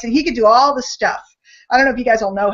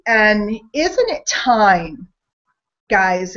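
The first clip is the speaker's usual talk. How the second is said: preacher-like slow build; slow, drawn-out rhetorical question; much slower than usual. Acted. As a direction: draw out "isn't it time" slowly.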